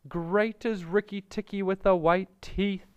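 high-pitched, fawning little songbird; Darzee the flattering bird. A narrator's reading.

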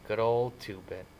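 slightly slow, lightly quavering. A narrator's reading. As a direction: let a slight quaver into the words. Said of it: "in a quavering voice".

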